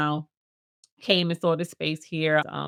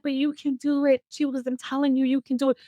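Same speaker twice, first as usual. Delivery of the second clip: much higher, pleading encouragement; hammed-up insistent teacher; imitating teacher urging; 8 semitones higher than usual. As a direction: play the teacher begging her to go. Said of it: "She was like".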